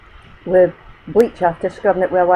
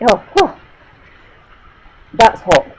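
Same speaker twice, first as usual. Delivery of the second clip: sudden burn reaction; 4 semitones higher, 5 dB louder than usual. Real, not acted. Chilli heat suddenly hits.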